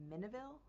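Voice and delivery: "Mineville" as MIN-uh-vil, questioning; softly tested "Mineville", said MIN-uh-vil; trying a pronunciation. Shown as a demonstration. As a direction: try "Mineville" with stressed MIN and short vowels, rising. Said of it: "how to pronounce this".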